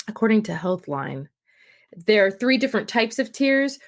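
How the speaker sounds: ordinary talk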